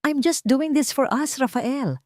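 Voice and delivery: mataas at matinis na sigaw. A narrator's reading. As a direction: sumigaw nang may pagkukunwari. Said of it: "sigaw ni Solen". (matinis na sigaw ni Solen)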